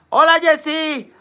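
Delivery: happy sing-song hello; excited greeting. Real, not acted. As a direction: greet her with delight. Greeting arriving friend.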